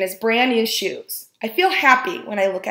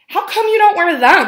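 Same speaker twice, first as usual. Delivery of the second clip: put-on curious boy; 9 semitones higher, 7 dB louder than usual. A narrator's reading. Antonio voiced in story.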